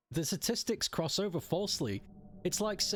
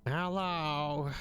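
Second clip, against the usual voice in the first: smoker voice; 2 semitones higher than usual. Pretend. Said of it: "did I sound like a smoker when I said that hello".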